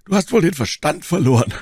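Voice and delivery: höher und lauter, fassungslos; ungläubig. Acted. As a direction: ruf es entgeistert heraus. Freunde halten Charles für verrückt. (Freunde rufen ungläubig zu Charles, fassungslos)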